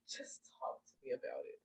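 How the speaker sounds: soft, low whispered plea. Retold or imitated. Husband to her, a soft whispered request. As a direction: breathe it gently.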